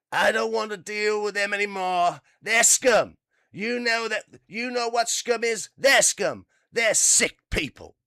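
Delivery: high, loud, hammed-up tycoon rant; Trump impression. Newsreader mocks the president calling Iranians scum, loud hammed-up rant. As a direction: bellow it like an outraged president. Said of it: "That, that was him".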